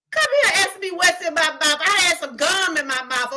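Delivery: ordinary delivery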